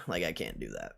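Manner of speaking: normal talking voice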